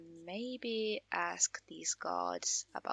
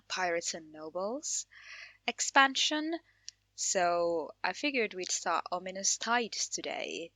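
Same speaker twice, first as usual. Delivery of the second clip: normal talking voice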